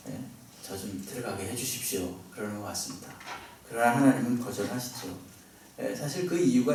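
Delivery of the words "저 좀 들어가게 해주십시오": hushed, sidelong appeal to God; sly quiet plea. Retold or imitated. Moses slipping a quiet plea to God. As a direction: murmur it softly as if slipping it in. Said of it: "슬쩍 말하는 것 같아요".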